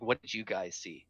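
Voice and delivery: usual speaking voice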